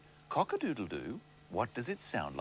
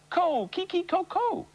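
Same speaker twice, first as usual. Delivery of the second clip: high louder clucky crow; rooster call; 5 dB louder than usual. Retold or imitated. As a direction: crow it out sharply.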